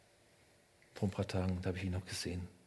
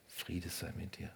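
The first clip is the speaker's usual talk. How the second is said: hushed blessing; 5 semitones lower, 13 dB quieter than usual. Acted. Jesus grüßt dich persönlich.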